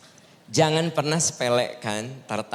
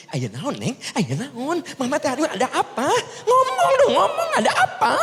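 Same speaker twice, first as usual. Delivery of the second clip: shrill hammed-up panicky mum, much higher, half laughing; fussy mother; 14 semitones higher, 3 dB louder than usual. Mother pesters her crying daughter with questions, hammed-up and panicky. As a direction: play the frantic mother pestering her child.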